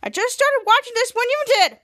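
whiny annoyed complaint; irritated. Retold or imitated. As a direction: snap back, fed up. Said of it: "who's irritated".

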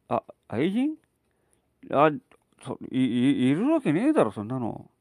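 put-on flustered drunken stammer; a drunk caught out, stumbling over words; dead drunk. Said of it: "泥酔して帰ってきた五郎".

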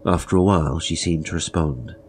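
ordinary delivery, nothing marked

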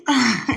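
bright laugh. Real, not acted. Laughs at her own impatience.

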